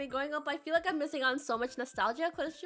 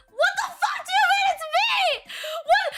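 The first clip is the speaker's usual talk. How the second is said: high, loud laughing protest; shrieking disbelief, half laughing; laughing shout; 9 dB louder than usual. Real, not acted. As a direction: yell it through laughter in disbelief. Laughing outrage at hamster comparison.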